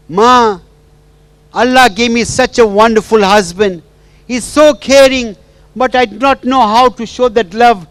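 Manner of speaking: high, loud, pleading and tearful; tearful plea to mother. Retold or imitated. Wife pours out her hurt to her absent mother, tearful and loud. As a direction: cry out to the mother who left her. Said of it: "And she was crying".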